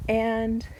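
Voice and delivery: ordinary talk, nothing marked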